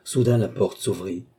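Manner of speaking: plain reading voice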